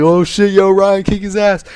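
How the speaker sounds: mock rowdy onlookers; imitated crowd egging on. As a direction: cheer on a schoolyard fight.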